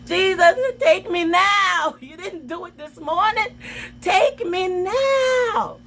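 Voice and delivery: hammed-up crying plea. Arrested teen pleading with Jesus, wailing. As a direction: cry out to Jesus, over the top. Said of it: "I was like".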